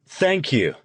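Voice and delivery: high, enthusiastic stage greeting; full of enthusiasm. A narrator's reading. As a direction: greet the crowd like a showman. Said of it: "his voice was pleasant and full of enthusiasm".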